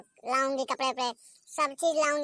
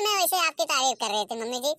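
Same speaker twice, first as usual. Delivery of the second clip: normal talking voice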